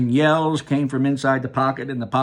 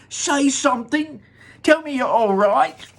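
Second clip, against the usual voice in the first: anxious mother; 11 semitones higher, 5 dB louder than usual. Acted. Mother pleading with her son.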